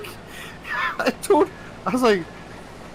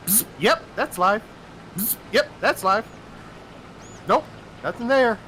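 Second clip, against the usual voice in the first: higher-pitched, matter-of-fact test call-outs; lightly voiced background electrician; 7 semitones higher than usual. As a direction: mimic zaps, then report each result. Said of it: "you hear in the background".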